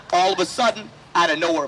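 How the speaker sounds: ordinary talk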